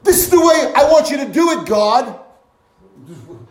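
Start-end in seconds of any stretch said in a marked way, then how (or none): 0.0-2.2 fast, loud, high bossy prayer; mock demanding shout at God. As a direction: order God around. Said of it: "false expectation".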